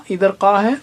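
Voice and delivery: normal talking voice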